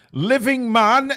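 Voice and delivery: high, slow, rising call to the winner; excited call. Acted. Repeating the winner.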